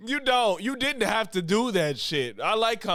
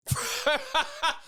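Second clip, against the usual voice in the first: high giggle; 10 semitones higher than usual. Real, not acted. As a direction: burst of high laughter. Laughing at the list.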